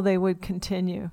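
usual speaking voice

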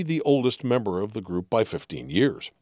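ordinary delivery

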